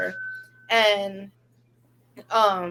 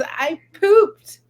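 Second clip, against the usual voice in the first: put-on higher, louder little-kid voice; imitating young daughter; 9 semitones higher, 9 dB louder than usual. Potty-trained toddler confesses to mom, imitated child voice. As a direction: announce it loudly like a small child. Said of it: "She goes".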